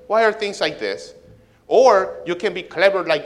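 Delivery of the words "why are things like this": high, loud mock whine; hammed-up whiny grumble; whining. Shown as a demonstration. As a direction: complain like a sulky kid. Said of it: "complain and whine and say".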